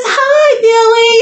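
mother cooing hi over speakerphone; mom's sweet hello. Retold or imitated. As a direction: coo hello like a tickled mom. Mom greets caller sweetly.

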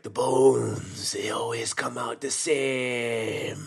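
slow, drawn-out bad Jamaican voodoo voice; stretched-out cryptic Jamaican movie line; cryptic Jamaican accent. Host mocking Jamaican voodoo movies, cryptic Jamaican accent. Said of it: "They were always saying things like".

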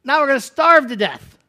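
loud, high grumbling complaint; whiny complaining Israelites. Acted. Grumbling wanderers protesting to Moses, high and loud. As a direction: whine at Moses like starving people.

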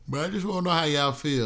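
talking through a yawn; stretched out mid-yawn. Real, not acted. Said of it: "I'm yawning".

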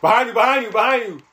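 high, loud panicked yelling; frantic screaming. Real, not acted. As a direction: scream the warning over and over. Enemy behind teammate, frantic callout.

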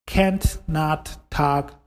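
Can't not talk said slowly, word by word; slow repeat. Shown as a demonstration.

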